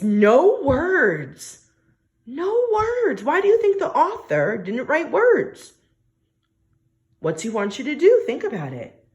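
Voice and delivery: usual speaking voice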